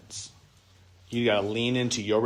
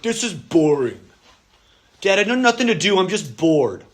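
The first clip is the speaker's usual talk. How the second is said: put-on bored kid; 5 semitones higher, 3 dB louder than usual. Child complaining of boredom.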